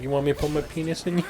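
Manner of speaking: playful mock come-on; mock seductive offer. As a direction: toss off a cheeky come-on, lightly voiced. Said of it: "I would just be like".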